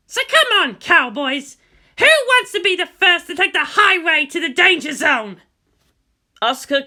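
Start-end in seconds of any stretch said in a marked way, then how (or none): 0.1-5.4 high, loud, gleeful taunting of the enemy; cocky battle taunt. Acted. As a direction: egg them on, loud and high. Pilot taunts enemies over radio.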